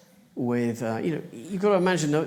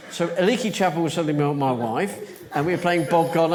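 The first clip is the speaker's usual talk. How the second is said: normal talking voice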